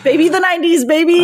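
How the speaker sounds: playful celebration; hyped. Real, not acted. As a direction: hype the nineties up for fun. Joking about nineties CD.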